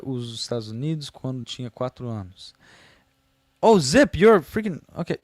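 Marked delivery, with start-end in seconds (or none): 3.6-5.2 high, loud excited yelp; excited outburst. Real, not acted. Streamer reacts to a viewer's message, excited and loud.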